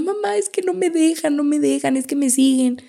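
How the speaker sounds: frightened girl pleading with her mother at night, imitated; imitating a frightened child. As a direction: replay the scared little girl calling mom. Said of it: "yo así gritando y pataleando y quejándome".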